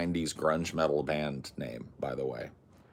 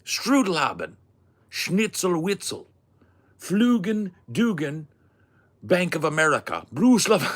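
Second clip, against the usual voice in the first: mock German; 10 semitones higher, 6 dB louder than usual. Shown as a demonstration. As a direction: belt nonsense words in a hammed-up German accent. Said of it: "they said it in German".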